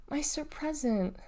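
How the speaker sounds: slow, soft and heartbroken; sad, barely speaking. Acted. Deli tells her mom what didn't come, sad and faint. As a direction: let it almost fail to come out. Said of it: "rasp was so filled with sadness".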